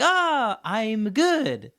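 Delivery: high, loud mock pupil's cheer; delighted pupil. Class clown finally understanding his teacher, high and hammed-up. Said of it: "And he's like".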